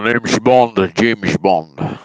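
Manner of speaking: loud mock Connery Bond line; Sean Connery impression. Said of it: "Sean Connery".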